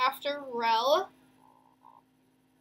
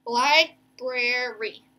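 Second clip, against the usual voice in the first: "Library" slow, three separated syllables; 3 dB louder, much slower than usual. Shown as a demonstration. Teacher confirming the last syllable, "Library" in slow parts. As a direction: pause between the syllables of "Library". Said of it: "our last syllable is just gonna be that E".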